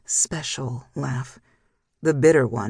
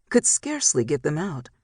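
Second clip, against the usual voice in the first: plain reading voice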